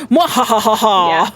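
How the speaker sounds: slow, hammy evil cackle; evil villain laugh. Retold or imitated. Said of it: "typical villain style".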